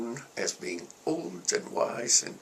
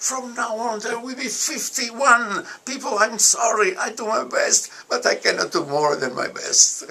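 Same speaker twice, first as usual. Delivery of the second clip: retold rousing declaration; 9 semitones higher, 5 dB louder than usual. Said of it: "and I say".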